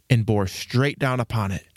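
ordinary delivery, nothing marked

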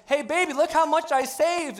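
gushing over a deal; proud wife. Retold or imitated. Wife to husband about shopping, high gushing boast. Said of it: "When you, when you go".

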